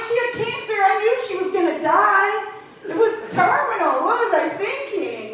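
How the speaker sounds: very high-pitched comic self-reproach; mock exasperation. Comic kicking himself over loans he never took, mock exasperated. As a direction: whine it in exaggerated disbelief. Mock outrage at own missed chance.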